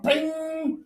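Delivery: high, drawn-out "bing"; soft, ringing sound effect; imitated spring ping. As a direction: mimic a small part flying off. Imitates screw spring flying off.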